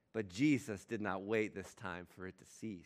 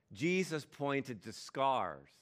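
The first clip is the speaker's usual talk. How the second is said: ordinary delivery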